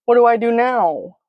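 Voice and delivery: high-pitched helpless question; tearful distress. A narrator's reading. Crying child asks dad for help, tearful and helpless. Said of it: "Fresh tears spill down my cheeks".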